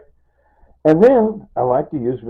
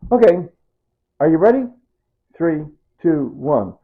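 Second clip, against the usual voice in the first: higher and louder playful countdown farewell; cheery sign-off; 7 semitones higher, 7 dB louder than usual. Real, not acted. Vlogger saying goodbye to viewers, cheery countdown.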